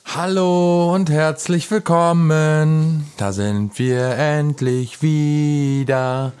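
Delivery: drawn-out, sing-song show opener; slow announcer welcome. Acted. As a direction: stretch the welcome out slowly.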